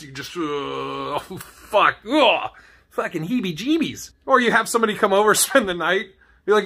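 ordinary talk

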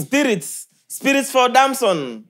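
slow, high calling out to spirits; drawn-out summoning plea; mock spirit summoning. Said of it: "Summon them".

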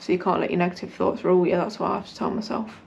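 normal talking voice

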